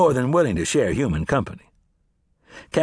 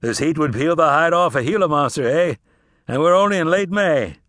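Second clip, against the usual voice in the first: genial, showy travelling salesman; talkative drummer; 5 semitones higher, 4 dB louder than usual. Acted. Drummer making small talk with Cass about the heat. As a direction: chat like a friendly peddler.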